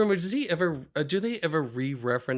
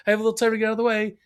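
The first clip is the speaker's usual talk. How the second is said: quick mock Homer; 8 semitones higher than usual. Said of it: "he's like".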